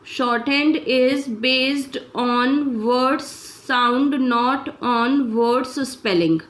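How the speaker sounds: very slow, word by word, like a dictator reading for shorthand; slow dictation. Shown as a demonstration. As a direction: dictate each word slowly and evenly. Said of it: "तो dictator क्या बोलेगा आपको".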